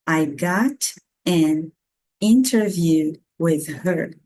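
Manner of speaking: GOT AN INTERVIEW word by word, T sounded; very slow teaching model with pauses; slow, separated words. Shown as a demonstration. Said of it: "de uma forma mais rápida".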